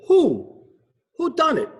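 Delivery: high, hooting who; owl hooting. Acted. Owl detective hooting his question. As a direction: hoot it out high. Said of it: "Hubert cried".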